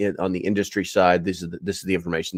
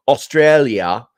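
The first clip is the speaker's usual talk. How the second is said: drawn-out, louder stab at an Australian accent; amateur mock-Australian accent; attempted Australian accent; 4 semitones higher, 7 dB louder, slower than usual. Shown as a demonstration. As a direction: have a clumsy go at sounding Australian. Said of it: "I can't do an Australian accent".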